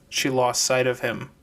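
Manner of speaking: plain reading voice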